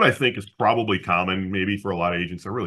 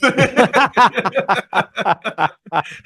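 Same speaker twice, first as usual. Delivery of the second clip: big laugh; 12 semitones higher, 7 dB louder than usual. Real, not acted. Laughs at own story.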